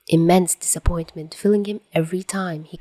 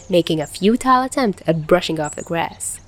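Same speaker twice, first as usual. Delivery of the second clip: usual speaking voice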